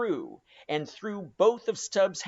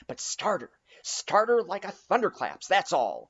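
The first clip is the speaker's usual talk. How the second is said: cried out; 3 semitones higher than usual. A narrator's reading. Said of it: "cried Stubbs".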